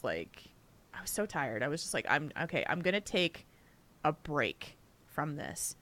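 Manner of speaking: soft, low and tired; drained, barely getting the words out; a worn-out murmur; exhausted. Retold or imitated. Exhausted retelling.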